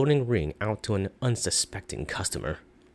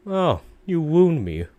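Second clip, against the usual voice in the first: high, loud, slow mock hurt; mock wounded merchant; 9 semitones higher, 5 dB louder, slower than usual. Shopkeeper faking hurt at the customer, high and theatrical. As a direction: ham up being offended.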